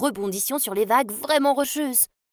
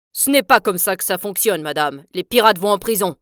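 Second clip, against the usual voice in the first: louder, firm refusal; a louder soldier refusing the pleading mother; stern soldier; 2 semitones lower, 4 dB louder than usual. Acted. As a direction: shut her down coldly.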